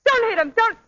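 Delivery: loud cry rising very high; alarmed plea. Acted. Woman stopping boxer from hitting her partner, loud alarmed plea. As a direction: desperately shout to stop the punch. She begs him not to hit.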